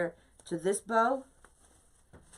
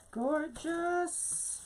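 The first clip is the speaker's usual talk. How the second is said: delighted; 11 semitones higher, 3 dB quieter than usual. Real, not acted. Admiring her floral arrangement.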